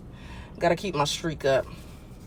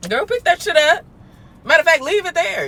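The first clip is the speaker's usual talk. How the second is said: sassy; 9 semitones higher, 5 dB louder than usual. Acted. Sassy advice to messy girl.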